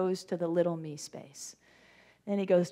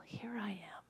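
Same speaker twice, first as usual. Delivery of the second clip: whispered answer; 16 dB quieter than usual. Acted. Moses answers the call.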